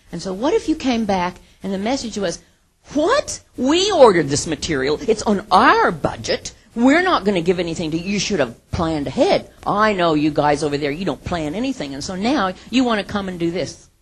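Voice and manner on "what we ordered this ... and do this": indignant refusal. Retold or imitated. Other department snapping at the accounting guys, loud indignant. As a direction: slam the door on them, loudly.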